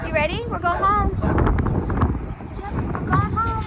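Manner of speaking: high, loud cheerful pet voice; talking to a horse. Real, not acted. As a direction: coax the animals warmly along. Unloading horses, coaxing them home.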